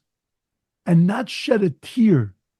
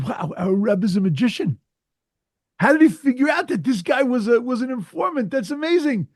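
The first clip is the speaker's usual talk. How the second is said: amazed awe; 5 semitones higher than usual. Retold or imitated. Chassidim marvel at the Rebbe.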